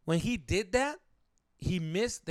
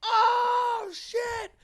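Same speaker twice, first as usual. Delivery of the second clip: drawn-out, very high cry of horror; imitated horrified scream; 7 dB louder than usual. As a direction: howl like a crowd seeing gore. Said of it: "we're all going".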